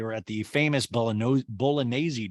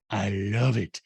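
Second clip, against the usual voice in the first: ordinary talk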